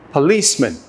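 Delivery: "Policeman" with the middle syllable punched; short schwa, then stressed LICE in "Policeman"; stress on second syllable. Shown as a demonstration. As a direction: say "Policeman" as puh-LEECE-mun. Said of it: "Stress on the second syllable".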